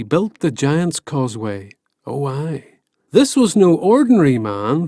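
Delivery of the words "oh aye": ordinary delivery, nothing marked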